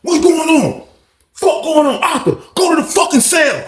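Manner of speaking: high, hard commanding yell; mock bark. Lawrence ordering Arthur to his cell, a mock bark. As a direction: boss him into the cell.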